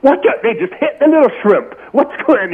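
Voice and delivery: high, animated mock South African exclamation; hammed-up South African fan, baffled and delighted; South African accent. Caller imitates the South African bar patron watching Flutie, mock South African outburst. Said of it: "guy with his South African".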